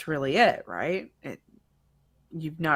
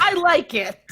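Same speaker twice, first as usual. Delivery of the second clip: high, loud interjection from above; high-pitched unseen onlooker; 12 semitones higher, 6 dB louder than usual. Acted. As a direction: chime in loudly from on high, pitched way up. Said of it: "a voice from above says".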